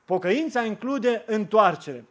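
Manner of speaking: slow and weighty; the preacher stressing the sermon's key line; slow refrain. Acted. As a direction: let each word land.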